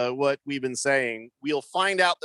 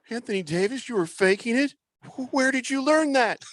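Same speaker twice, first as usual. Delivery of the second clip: higher-pitched questioning, rising in disbelief; hammed-up anti-drug ad father; shocked commercial dad; 8 semitones higher than usual. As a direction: confront your kid like an old PSA parent. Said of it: "Like the old drug commercial".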